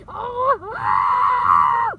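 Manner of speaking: loud, drawn-out scream. Acted. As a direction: let out a big loud scream. Screaming as wind gets worse.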